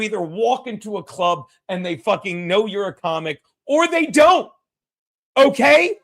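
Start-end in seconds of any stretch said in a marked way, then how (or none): 3.7-6.0 emphatic rant. Real, not acted. Ranting at Chad.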